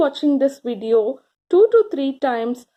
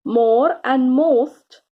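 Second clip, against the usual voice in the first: more and most drawn out slowly, each word weighted; teacher spells out more and most slowly for children; slow stressed keywords; much slower than usual. Shown as a demonstration. Teacher stresses more and most, slow and deliberate. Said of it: "you use the words".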